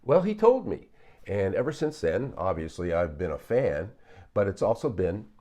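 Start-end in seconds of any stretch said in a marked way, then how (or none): none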